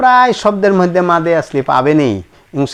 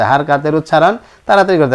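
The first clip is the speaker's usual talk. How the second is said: quick; faster than usual. Shown as a demonstration.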